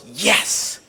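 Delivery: put-on excited girl. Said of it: "she goes".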